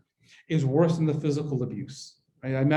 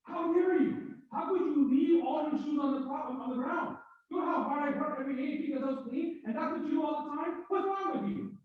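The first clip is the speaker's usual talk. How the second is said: high-pitched furious scolding, far higher than her own voice; angry yelling at spouse; 11 semitones higher, 9 dB quieter than usual. Shown as a demonstration. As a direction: scold your spouse for leaving shoes on the ground. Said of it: "I can try it with somebody".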